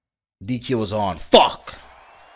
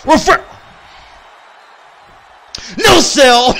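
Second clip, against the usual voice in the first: high shout then a laugh; excited shout; 10 semitones higher, slower than usual. Real, not acted. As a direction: yell it in disbelief. Move no-sold.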